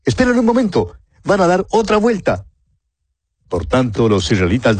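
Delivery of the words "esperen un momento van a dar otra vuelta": much higher, urgent call without extra volume; a lookout suddenly crying wait; startled warning. Acted. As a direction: watchman surprised, calling out in a high voice. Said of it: "el atalaya avisa".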